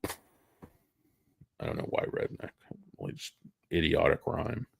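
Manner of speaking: hoarse, fading voice; losing voice. Real, not acted. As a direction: voice giving out. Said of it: "I think I'm losing my voice".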